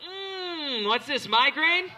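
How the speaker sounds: high, slow mock sniff of candle smell; sniffing a room. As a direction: wince at the sickly scent. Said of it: "you're like".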